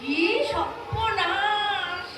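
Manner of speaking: slow, sing-song cry of dismay; dismayed wail. Acted. Bride wailing in dismay at her husband's roots. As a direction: draw it out like a mock lament.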